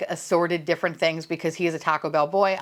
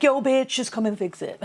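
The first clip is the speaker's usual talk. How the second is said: brash call; 6 semitones higher than usual. Retold or imitated. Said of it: "to be like".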